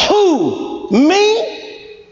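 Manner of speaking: acted questioning, pitch rising on Me; questioning reply. Congregant answering God's call, questioning.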